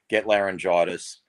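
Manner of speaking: ordinary talk, nothing marked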